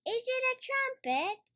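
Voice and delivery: put-on a small girl guessing eagerly; Peppa voice. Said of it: "says Peppa".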